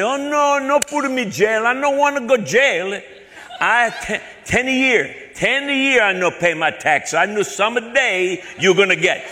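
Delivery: high, loud, pleading broken English; mock-naive broken-English caller. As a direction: plead dimly in drawn-out, accented broken English. Teller playing panicked foreigner to scammer.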